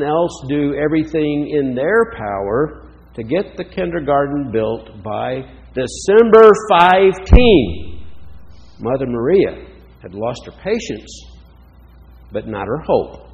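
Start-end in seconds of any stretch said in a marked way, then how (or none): 5.7-8.0 put-on loud, drawn-out cry in a German accent; heavy German accent. A narrator's reading. Mother Maria demanding the kindergarten be built, heavy German accent. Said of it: "her heavy German accent".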